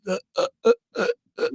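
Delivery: high, panicky stammer, unable to speak. Retold or imitated. Imitating himself tongue-tied in the live battle. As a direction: freeze up and stutter nervously. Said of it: "but I can't even talk".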